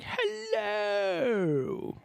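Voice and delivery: high, overdone British "Hello."; imitating bad British actors in a play; mock British hello. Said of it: "I sounded like those dudes in Always Sunny".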